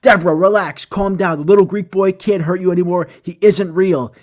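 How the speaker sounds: high and loud, half-shouted reassurance; hammed-up calming of a frantic patient; mock soothing shrink. Someone calming Deborah, loud and high-pitched.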